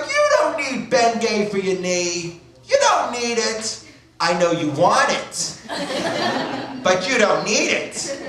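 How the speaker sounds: slow, high-pitched mock scolding; scolding mother. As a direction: stretch it out like a disapproving mother. Mother refuses son the ointment.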